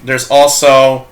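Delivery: ordinary talk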